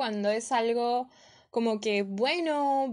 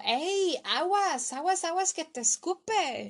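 a high warning call of aguas; retold Mexican warning yell; mock shout; 7 semitones higher than usual. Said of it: "un mexicano que me gritó".

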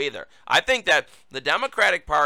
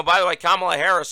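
ordinary talk

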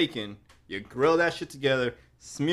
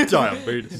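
laughing; 5 dB louder than usual. Real, not acted. Laughing repetition.

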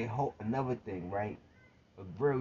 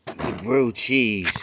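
loud, slow, drawn-out insistence; emphatic; 13 dB louder, slower than usual. Real, not acted. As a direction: hammer the point home. Insisting on his point.

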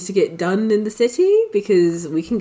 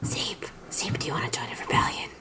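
whisper; 5 semitones lower, 11 dB quieter than usual. Acted. Secret invitation to companion.

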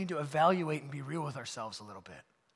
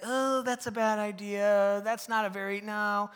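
kind gentle no; 3 semitones higher, slower than usual. Retold or imitated. Don kindly turning down a bad idea, slow. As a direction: let him down kindly. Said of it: "kindly guide me".

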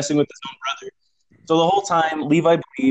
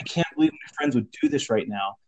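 lower, softer retold hurt; mildly heartbroken; 4 semitones lower, 6 dB quieter than usual. Pranked friend sitting alone, quietly heartbroken. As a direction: imitate a friend quietly let down. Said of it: "really heartbroken about it".

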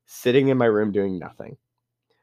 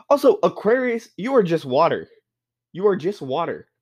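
incredulous; 6 semitones higher than usual. Real, not acted. Arguing about Aquarius sign.

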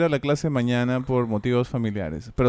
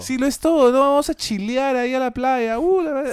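much higher, excited beach bro; a hyped guy craving beer and beach, pitched way up; beach-loving bro impression; 10 semitones higher, 4 dB louder than usual. Mimicking summer-loving beach bros, high and hyped. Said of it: "imitación de la gente que ama el verano".

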